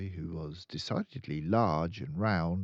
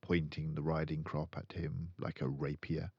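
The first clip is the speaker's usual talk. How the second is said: usual speaking voice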